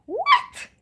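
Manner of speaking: high, loud, incredulous squawk; indignant what. Retold or imitated. To the Spirit in church, an outraged high-pitched what. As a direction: throw back a shocked what. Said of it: "I go like this, like".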